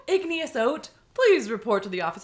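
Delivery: loud PA announcement; over the intercom. Retold or imitated. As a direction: page a student over the speakers. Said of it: "over the intercom".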